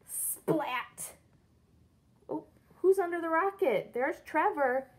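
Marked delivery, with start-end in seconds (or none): none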